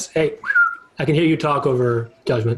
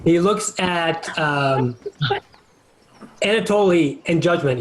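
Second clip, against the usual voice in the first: ordinary talk, nothing marked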